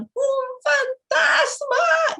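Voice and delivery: high, drawn-out terrified cry of a ghost; frightened shout. A narrator's reading. Disciples seeing Jesus on the sea, crying out in fright. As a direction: cry out in terror, stretching the word. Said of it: "y asustados gritaron".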